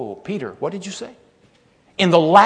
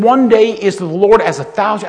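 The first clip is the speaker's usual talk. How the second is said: loud reading; 6 semitones higher, 11 dB louder than usual. Retold or imitated. Lady rereads the verse insistently.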